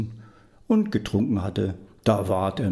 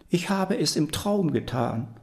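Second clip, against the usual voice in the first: grandmother voice; 7 semitones higher than usual. Acted. Grandmother excuses herself.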